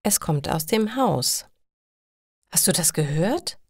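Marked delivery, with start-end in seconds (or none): 2.5-3.6 ängstlich. Acted. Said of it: "Er hat Angst".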